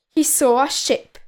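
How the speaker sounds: ordinary delivery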